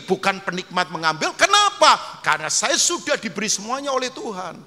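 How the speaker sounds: loud, driving sermon voice; full-force pulpit preaching; fiery preaching. Acted. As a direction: preach it hard to the whole church.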